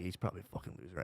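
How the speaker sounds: low, very soft put-down; quiet inner-voice dismissal; judging in his mind. Retold or imitated. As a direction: mutter a private verdict on a stranger. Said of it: "break them down in my mind".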